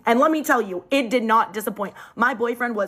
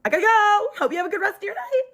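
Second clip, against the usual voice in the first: flustered exit; 11 semitones higher than usual. Retold or imitated. To his brother after the stare, flustered and hurried. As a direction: scramble out of the awkward moment fast.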